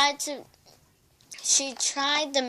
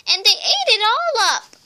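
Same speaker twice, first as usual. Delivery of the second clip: much higher and louder cub squeak; high squeaky shout; 9 semitones higher, 9 dB louder than usual. A narrator's reading. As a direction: squeal it out, upset little cub. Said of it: "in her high, squeaky voice".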